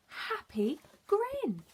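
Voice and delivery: usual speaking voice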